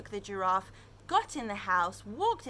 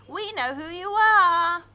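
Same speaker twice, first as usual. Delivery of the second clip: loud, high playful taunt; a big sing-song taunt at a hiding friend; taunting shout; 9 semitones higher, 12 dB louder than usual. Acted. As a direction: call it out to the hidden bunny. Animals taunting the hidden bunny.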